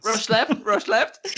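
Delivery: high, loud nerdy callout; hammed-up gamer callout. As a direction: bark the callout like a tryhard gamer. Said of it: "we become".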